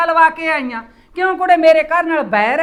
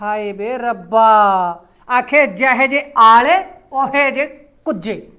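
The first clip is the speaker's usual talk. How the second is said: mock lament; 6 semitones lower than usual. Mother-in-law laments the news.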